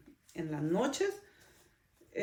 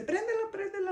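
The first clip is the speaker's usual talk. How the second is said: high, urgent plea to turn the light on; a retold frightened cry, pitched high; frightened pleading; 11 semitones higher, faster than usual. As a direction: beg like a scared husband in the dark. Husband frightened, begging for light.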